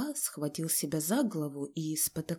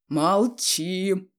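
loud stamping command; angry shout; 6 dB louder than usual. A narrator's reading. Father stamping at his defiant son, angry shout. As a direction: shout it down furiously. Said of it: "крикнул отец и затопал ногами".

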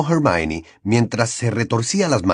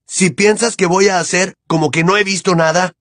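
raised angry voice; 8 semitones higher than usual. Acted. Harry arguing back.

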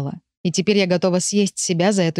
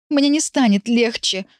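higher, slow, sobbing and hoarse; hoarse sobbing cry; 5 semitones higher than usual. Acted. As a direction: choke it out through tears. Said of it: "всхлипываю я сиплым шёпотом".

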